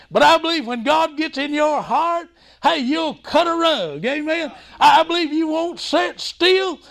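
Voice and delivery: high, ringing sermon delivery; fervent preaching. Real, not acted. Preacher urging the congregation to praise, fervent.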